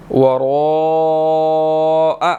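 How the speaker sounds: "वरा" with the a held very long; "वरा" drawn out extremely slowly; long madd. Shown as a demonstration. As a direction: stretch "वरा" four to five counts. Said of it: "four to five seconds ... लंबा करके पढ़ना है".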